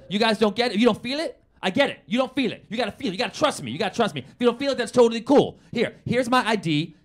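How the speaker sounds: high, urgent dealer's pitch; acted-out fast-talking hustler winning trust; pushy insistence. Dealer presses hesitant buyers to trust.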